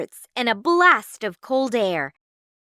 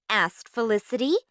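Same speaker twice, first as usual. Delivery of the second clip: usual speaking voice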